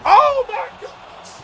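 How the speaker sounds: high, slow, shouted disbelief; real astonished outburst; stunned shout. Real, not acted. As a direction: yell it out in shock. Stunned by a play in game.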